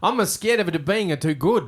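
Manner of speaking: high, loud mock Italian accent; hammed-up Italian voice. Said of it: "I'm gonna do an Italian accent".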